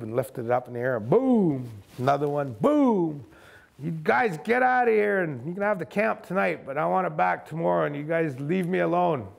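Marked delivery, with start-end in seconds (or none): 1.1-1.7 loud imitated rifle boom; gunshot noise. Imitating his warning shot in the air. 2.6-3.2 loud imitated rifle boom; gunshot noise. Imitating his warning shot in the air. 3.8-9.3 retold shout. Warning off the Sasquatches.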